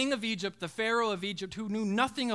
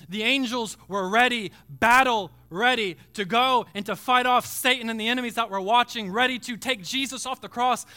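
loud, raised pulpit build; impassioned preaching; 3 semitones higher, 6 dB louder than usual. Real, not acted. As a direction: drive it forward with rising fervor. Preacher builds on angels' readiness.

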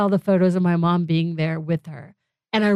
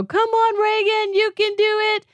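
mom cheering; 13 semitones higher than usual. Retold or imitated. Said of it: "that voice of my mom just go".

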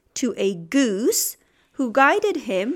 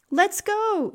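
put-on high eager shout of a young wolf; 9 semitones higher than usual. A narrator's reading. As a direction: call it out high and bold. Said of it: "shouted Wilf".

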